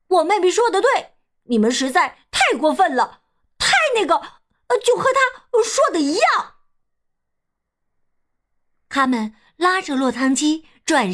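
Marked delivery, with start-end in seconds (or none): 0.1-6.5 put-on high, halting boy character; earnest storybook child searching for words; indignant brother. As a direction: back up your sister in a high, cross voice. Brother backs his sister.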